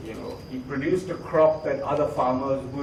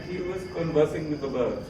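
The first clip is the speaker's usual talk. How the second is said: usual speaking voice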